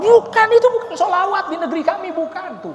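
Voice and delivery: high, loud laughing protest; Egyptian insisting. Retold or imitated. Egyptian correcting that it is no sholawat, laughing high protest. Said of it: "Saya bilang".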